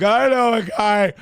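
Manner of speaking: high, loud mocking whine; mocking whiny liberal. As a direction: mimic an earnest activist. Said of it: "on the left being like".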